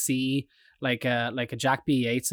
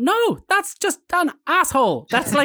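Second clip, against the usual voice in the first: 1 incredulous retort; 12 semitones higher, 5 dB louder than usual. Retold or imitated. Retorts to guide about Dali.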